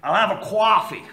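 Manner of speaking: attempted American accent. Shown as a demonstration. Ordering a coffee on request, attempted American accent. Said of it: "Try an American".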